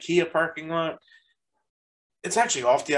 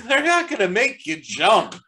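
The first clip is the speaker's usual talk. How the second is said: teasing; 9 semitones higher, 6 dB louder than usual. Retold or imitated. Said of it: "I'm like".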